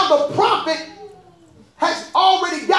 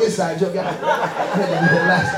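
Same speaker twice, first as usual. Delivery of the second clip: ordinary delivery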